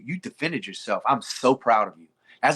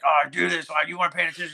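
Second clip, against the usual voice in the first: mock barking parent; 4 semitones higher than usual. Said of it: "he would bark on the side of the mat".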